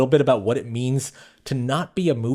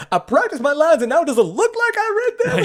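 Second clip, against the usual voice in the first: high, loud hammed-up outburst; mock freak-out; 14 semitones higher, 4 dB louder than usual. Actor berating himself in the mirror, mock freak-out. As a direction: imitate a furious meltdown, voice shooting up. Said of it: "like, a complete freak out".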